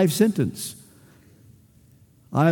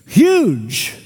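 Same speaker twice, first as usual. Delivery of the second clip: Huge stretched with a falling tune; emphatic huge; 3 dB louder than usual. Real, not acted. Preacher to congregation, Huge said with weight. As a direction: lean hard into Huge.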